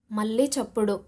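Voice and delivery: usual speaking voice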